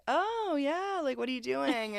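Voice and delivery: high-pitched mock flirting; put-on flirty interest. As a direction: coo it up high, playing the flirt. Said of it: "The hair is flipping".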